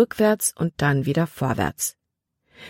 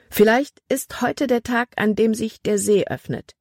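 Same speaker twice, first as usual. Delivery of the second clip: higher-pitched, lightly exclaimed line; mild exclamation; 6 semitones higher than usual. A narrator's reading. Libby exclaims on deck about the lake opening, mildly excited. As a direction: call it out with a touch of excitement. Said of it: "rief Libby aus".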